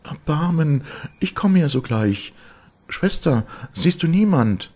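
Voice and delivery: very high, loud, frightened woman's voice; high-pitched desperate cry; desperate pleading. A narrator's reading. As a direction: beg for mercy, then call out urgently. Terrified wife begs, calls sister.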